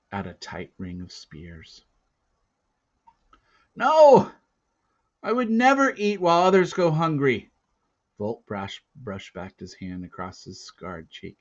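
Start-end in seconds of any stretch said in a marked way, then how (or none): 3.7-7.5 high, loud, desperate denial; protesting cry. Acted. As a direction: insist on his innocence loudly. Accused boy protests.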